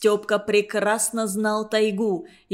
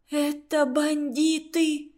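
медленно, сдавленно, со страхом; прошептать в ужасе, растягивая; испуганный шёпот; 4 semitones higher, slower than usual. Acted. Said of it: "шептала Люська". (испуганный шёпот)

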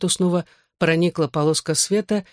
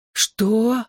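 higher, groaned question; moaning dismay; 6 semitones higher than usual. A narrator's reading. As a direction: moan it in mild dismay. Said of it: "простонал я". (жалобно, со стоном)

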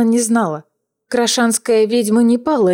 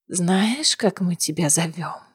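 hoarse quiet voice; 3 semitones lower, 7 dB quieter, slower than usual. A narrator's reading. Battered prisoner speaks hoarsely.

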